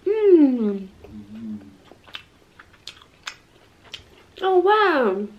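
chewing while speaking; muffled by food; mouth full. Real, not acted. Seen 0: Bites at -5; cheeks full, jaw chewing through the "mmm.

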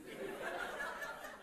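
soft airless chuckle; breathy laugh. Real, not acted. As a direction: laugh under the breath at the memory. Laughing at the farmer's reply.

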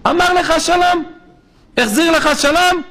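high, eager voicing of the elder rabbi; old sage asking. Chafetz Chaim asks the young rabbi, high eager question. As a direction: ask it like the revered sage, leaning in.

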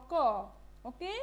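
ordinary delivery

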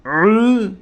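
tighten the throat and squeeze out "ü"; throat-squeezed ü. Shown as a demonstration. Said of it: "ü demeye çalışacağız". ("ü" gırtlağı sıkarak)

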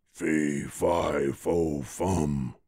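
low, soft menacing giant. A narrator's reading. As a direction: rumble it like a giant. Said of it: "The giant said".

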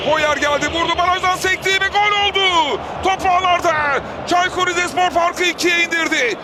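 screaming football commentator. Real, not acted. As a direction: build up to the goal, then erupt. Build-up to a goal. (bağıran futbol spikeri)

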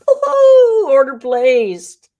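high, loud, slow victory call; thrilled squeal, drawn out; triumphant cry. Real, not acted. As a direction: crow the win. Triumphant order completion.

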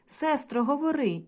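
lightly voiced third brother begging; calling brother. A narrator's reading. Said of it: "Третій гукає".

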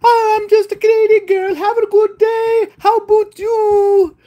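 put-on very high-pitched pretty Canadian girl; hammed-up Canadian girl in falsetto. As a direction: play a sweet Canadian girl, high and drawn out. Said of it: "pretty Canadian girl was like".

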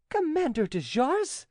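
startled high-pitched question; Angelique exclaiming. A narrator's reading. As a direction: exclaim it high, in acted surprise. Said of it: "exclaimed Angelique".

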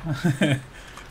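a quick amused laugh; light chuckle at the controls; short laugh. Real, not acted. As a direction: laugh at yourself. Laughs at own bad coordination.

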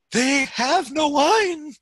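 high, loud, drawn-out plea; mother's complaint. A narrator's reading. Pastor reads Jesus' mother's line to her son, high complaint. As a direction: stretch it out high, loud and slow.

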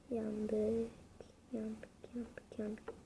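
barely voiced whisper of defeat; whispered despair. Real, not acted. Gamer whispering to himself after losing, hushed whisper. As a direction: breathe the words out, voice gone.

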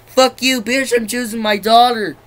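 hammed-up furious father; mock angry dad. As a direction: bark it as the dad choosing his daughter. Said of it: "how he's just like".

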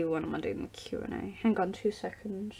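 breathy unvoiced whisper to herself; quick private whisper; whispered aside. Real, not acted. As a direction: drop to a whisper while checking something. Aside while checking the date.

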